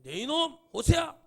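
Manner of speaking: high-pitched scolding call; stern rebuke. Acted. God answering Hosea's back-talk, stern scolding shout. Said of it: "하나님 호세아를 꾸짖죠".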